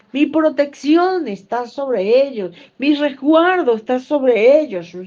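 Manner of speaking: high, loud sermon cadence; fervent preaching. Acted. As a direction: preach it out with fire.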